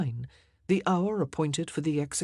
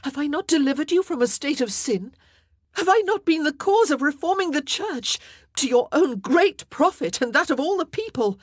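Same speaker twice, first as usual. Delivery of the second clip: loud, much higher indignant questions; defiant; 11 semitones higher, 8 dB louder than usual. Acted. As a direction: demand he admit her worth. Queen's defiant rebuttal continues.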